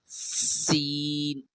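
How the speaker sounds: سین soft and long, drawn out; thin seen. Shown as a demonstration. Teacher drawing out seen gently for pupils. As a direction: draw سین out lightly. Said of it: "بہت easy ہے یہ".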